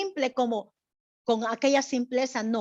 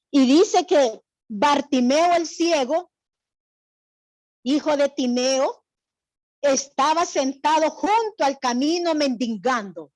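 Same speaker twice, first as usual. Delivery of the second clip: slow, raised reading of the Bible passage; scripture reading; 4 dB louder than usual. Acted. Teacher reading scripture to the class, slow and raised. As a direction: read the verse out slowly to the class.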